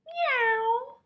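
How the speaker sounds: high, loud drawn-out meow; puppy meowing. A narrator's reading. Story reader voicing George the puppy, high loud meow. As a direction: meow loudly like a cat, way up high. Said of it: "George went".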